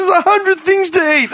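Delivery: high, put-on nagging voice; mimicking a fussy parent, pitched high; mock high nag. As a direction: squeak it out like a scolding mum. Retold mum's reply, high voice.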